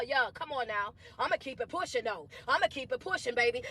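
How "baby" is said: normal talking voice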